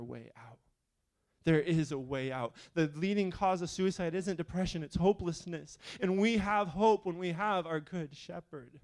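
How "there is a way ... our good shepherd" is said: loud, rising fervent preaching; preaching at full force. Real, not acted. Pastor stirring the congregation to follow, fervent preaching. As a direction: build the sermon to a roar.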